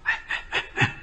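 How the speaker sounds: soft, voiceless mimicked "psst"-like call; furtive calling out. Woman with a child calls a passerby over, hushed. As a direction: beckon someone under your breath. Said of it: "喊我".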